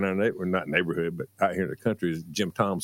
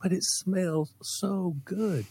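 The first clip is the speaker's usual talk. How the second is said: put-on high, slow dreamy child; lightly voiced child telling his mom why; dreamy kid voice; 9 semitones higher, much slower than usual. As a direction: savour the words like a kid sniffing gas. Kid pleading in story.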